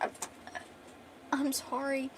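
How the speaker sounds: weak, soft dying gasp, acted out; gasping apology. Dying girl apologizing to him, soft mock gasping. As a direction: barely get it out, as if dying. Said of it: "She's gasping".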